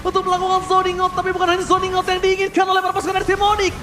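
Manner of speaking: loud fast play-by-play hype; esports caster. Acted. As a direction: call the teamfight at full energy. Hyped play-by-play casting.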